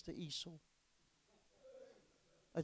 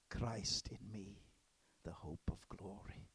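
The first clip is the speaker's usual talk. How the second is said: reverent whisper; 6 semitones lower, 8 dB quieter than usual. Retold or imitated. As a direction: let it fall into a whisper. Retold answer, slowed to whisper.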